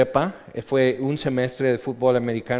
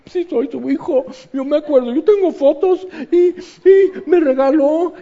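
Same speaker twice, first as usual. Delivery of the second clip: put-on much higher, louder pleading child voice; insecure child; 13 semitones higher, 6 dB louder than usual. Believer insisting to God he is His child, high insecure plea. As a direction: plead like an unsure kid proving he's a son. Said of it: "con esa inseguridad".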